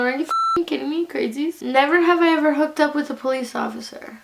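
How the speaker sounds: ordinary delivery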